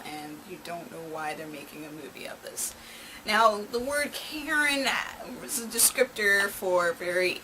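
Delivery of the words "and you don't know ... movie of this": hushed whispered aside; whispering. Real, not acted. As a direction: whisper it like a secret. Aside about bad meme movies.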